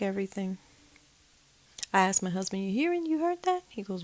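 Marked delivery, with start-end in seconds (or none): 2.6-3.6 high, alarmed asking; startled question. Retold or imitated. To her husband about the footsteps, high and alarmed. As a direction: ask sharply, pitched up in alarm.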